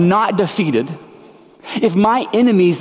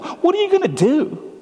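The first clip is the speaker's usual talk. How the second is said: mocking taunt; 11 semitones higher than usual. Mocking leaders taunt Jesus.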